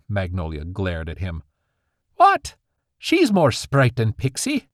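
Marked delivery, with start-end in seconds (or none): 2.1-4.7 high outraged What, then a slower protest; indignant mother. Acted. Mother objects to her father's slur, indignant and much higher. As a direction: bristle at the insult.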